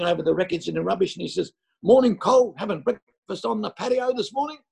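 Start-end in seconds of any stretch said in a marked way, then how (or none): 1.8-4.6 put-on cheerful teen greeting.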